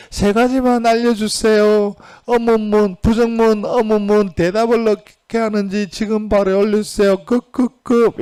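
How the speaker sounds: high, urgent voice of a desperate student asking online; pleading student. Retold or imitated. Teacher reading a student's urgent online question in a high pleading voice. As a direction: beg for the answer like a panicked student. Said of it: "인터넷에 올라온 질문을 보면서".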